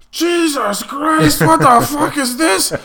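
very high, loud, drawn-out complaint; hammed-up disgusted outburst, slow and loud; mock bitching. Lieutenant climbs into the puked-in aircraft, bitching loudly. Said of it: "And he's bitching".

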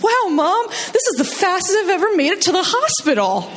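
put-on high, excited kid voice; thrilled kid. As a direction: gush about the speed like it's a ride.

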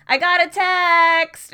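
loud, high, slow mock "I got a text!"; imitating Americans' version. Mocking how Americans say her phrase, loud and high. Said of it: "Americans don't have that accent".

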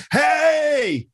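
very high, drawn-out mock call; exaggerated greeting. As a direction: shout it as if hailing someone far away. Mimics exaggerated American TV greeting.

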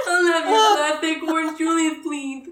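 high, loud put-on ordering voice; mock customer voice. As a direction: play the dopey customer, giggling. Acted customer ordering drink.

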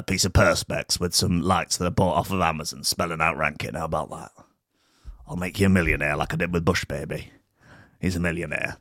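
lightly voiced noir showbiz mogul, low and soft; low, quiet patter of a lightly played New York fixer; hint of New York mogul. Showbiz mogul promising Rankin he'll be a star, low New York patter. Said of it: "New York detective".

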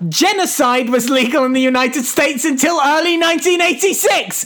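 high, emphatic, exasperated rant; worked-up moral objection; indignant lecture. Real, not acted. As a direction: lecture them in high-pitched outrage. Mock-serious lecturing co-host about legality.

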